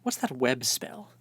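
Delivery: lightly voiced forgetful old wizard, pitch climbing; muttering to himself, trying to recall a spell; befuddled wizard. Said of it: "He's like".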